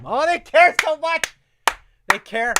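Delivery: high, loud sarcastic gush; mock gushing. Mocking the vaccine ad's caring team, high sarcastic gush. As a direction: gush over them with heavy sarcasm.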